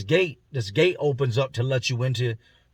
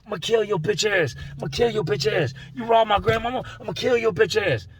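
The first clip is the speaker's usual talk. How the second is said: high, heated threats; raised-pitch mock fury; furious threat; 7 semitones higher than usual. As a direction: retell a man's rage. Enraged inmate voiced in story.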